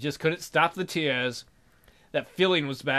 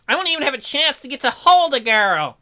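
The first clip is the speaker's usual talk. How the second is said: high, loud whining bear; loud lament; 10 semitones higher, 7 dB louder than usual. Acted. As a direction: wail about your lonely life.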